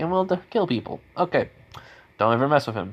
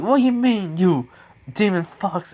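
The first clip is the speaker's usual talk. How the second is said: scornful villager; 8 semitones higher than usual. Retold or imitated. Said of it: "they go like".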